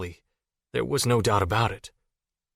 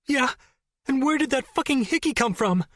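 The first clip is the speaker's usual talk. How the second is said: high, sharp accusing snap; snapped. Acted. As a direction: fire the question back at him. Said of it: "she snapped".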